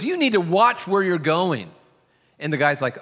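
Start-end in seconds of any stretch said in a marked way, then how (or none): 0.0-1.7 higher, louder scolding retort; old man scolding. Retold or imitated. Old man refusing to let it go, louder angry scolding. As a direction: scold him for bumping into you. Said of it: "the old man will not let it go".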